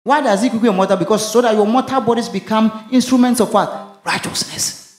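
high, rising preacher's cry; full-force gospel preaching; fervent preaching. Acted. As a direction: preach it out with mounting fire.

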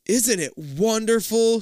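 high, slow gush of delight; exclaiming mom. A narrator's reading. Mom exclaiming over the sundial exhibit, delighted. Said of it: "she exclaims".

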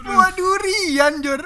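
high, drawn-out words through real laughter; helpless laughter. Real, not acted. Joke teller repeating his punchline to co-host, cracking up. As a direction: squeal the punchline slowly, cracking up.

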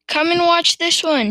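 loud, high summons; calling out. A narrator's reading. Farmer to his wife across the yard, calling out. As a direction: call her over excitedly. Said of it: "he called out to Missus Cranky".

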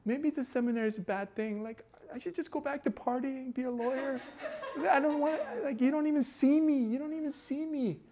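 high pleading retold despair; half-laughing imitation of his own sobbing prayer; mock whiny crying prayer. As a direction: whine it up high like a hurt kid. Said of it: "And I was crying in my bed".

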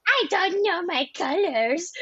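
put-on high, slow babyish voice. Dad doing a baby character in the car, high baby voice. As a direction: play a clueless baby in a high voice. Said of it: "character called Baby Daddy".

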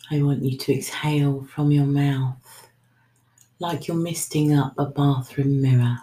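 normal talking voice

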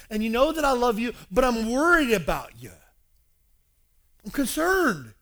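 high, loud, pleading preacher; impassioned concern. Retold or imitated. Paul telling beloved church he worries, impassioned. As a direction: press the worry on them forcefully. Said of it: "He says in, in previous verses".

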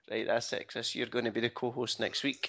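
normal talking voice

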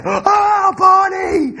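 high, loud call across the pool; lifeguard yelling. Retold or imitated. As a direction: shout the name as you run. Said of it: "Like that".

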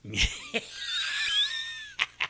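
shrieking high laugh; high laugh burst. Real, not acted. Cracking up at his wife joke, high laugh.

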